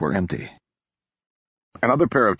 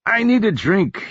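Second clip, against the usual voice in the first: higher, louder grand announcement; proclaiming; 13 semitones higher, 4 dB louder than usual. Acted. Hungover Odric announcing his need to Marcus, mock grand. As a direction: declare it like a decree, lightly hammed-up. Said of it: "he proclaimed".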